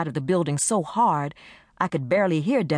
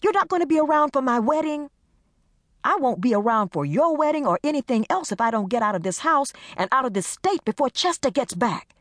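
upset friends; 10 semitones higher than usual. Acted. Hurt question and desperate reply.

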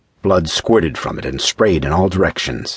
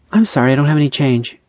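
high, quick brush-off; dismissive; 9 semitones higher, 3 dB louder, faster than usual. A narrator's reading. Woman turning away a stranger asking for change, dismissive. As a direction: dismiss them briskly in a higher voice. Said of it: "Julie said dismissively".

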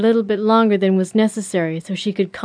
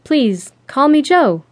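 slow, higher, hesitant reply; 5 semitones higher, 3 dB louder, slower than usual. A narrator's reading. Jo asks Callum to use her first name, hesitant. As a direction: take it slowly, a little unsure. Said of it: "she said hesitantly".

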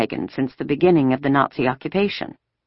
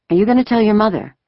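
put-on girl's voice; 7 semitones higher, 4 dB louder, faster than usual. A narrator's reading. Ellen asks on stairs.

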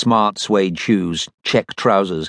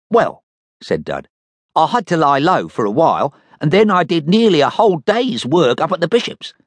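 Dud Check's voice; 8 semitones higher than usual. Acted. Dud answers captain.

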